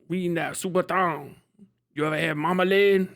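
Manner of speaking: put-on Creole local voice; Creole accent. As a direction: welcome them in a hammed-up Louisiana Creole accent. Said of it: "Ain't nobody got a problem with the Creole".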